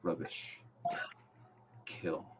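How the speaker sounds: low, soft villain command; hushed, sinister voice in the head; disembodied villain voice. Acted. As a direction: give the order quietly and low. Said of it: "you hear the voice say".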